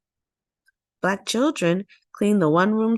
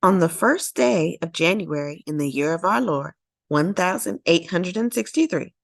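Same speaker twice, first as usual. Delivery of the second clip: plain reading voice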